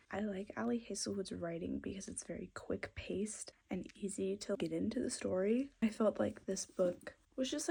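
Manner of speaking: soft, low-key book chat; quietly chatting about a book; hushed talk. Real, not acted. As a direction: keep it down, almost whispering.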